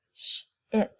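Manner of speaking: "Ship" whispered, stretching the sh; breathy sound-it-out of "Ship". Shown as a demonstration. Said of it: "Do you hear the I sound?".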